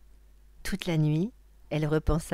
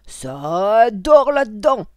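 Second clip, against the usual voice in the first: loud, high, drawn-out holler; big witch's yell, stretched out; witch's shout; 8 semitones higher, 15 dB louder, slower than usual. Acted. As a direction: shout to wake the whole place. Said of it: "cria la sorcière".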